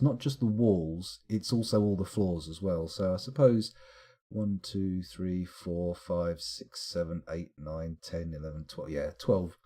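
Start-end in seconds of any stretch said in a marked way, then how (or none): 4.3-8.9 counting, fading out. Real, not acted.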